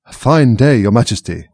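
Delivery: usual speaking voice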